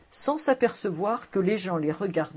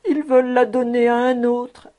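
loud, higher-pitched despairing protest; desperate interruption; 5 semitones higher, 6 dB louder than usual. Acted. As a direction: cut in with acted despair. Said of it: "désespéré".

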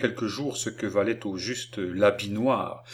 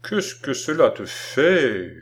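slow, higher-pitched irritated objection; growing ill humour; 4 semitones higher, 4 dB louder, slower than usual. A narrator's reading. Man objecting to the child's questions, ill-humoured. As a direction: object with mounting crossness. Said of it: "dont la mauvaise humeur augmentait".

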